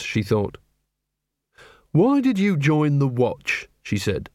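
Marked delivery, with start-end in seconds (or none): 1.9-3.7 slow, dry skeptical question; a hint of weary wry woman; Angua. A narrator's reading. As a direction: ask it slowly, half amused. Angua asks Carrot.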